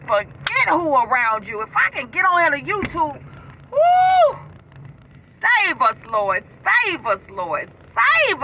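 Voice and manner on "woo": high, loud praise whoop; ecstatic woo. Real, not acted. Preacher whooping in praise to her viewers. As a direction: let out a spirit-filled whoop.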